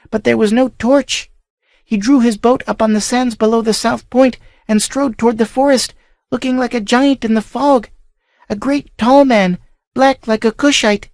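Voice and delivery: put-on a scared young girl recalls what she saw; frightened girl telling. Girl tells her uncle's household of the stranger, fearfully.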